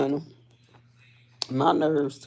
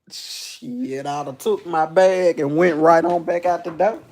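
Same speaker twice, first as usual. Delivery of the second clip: ordinary delivery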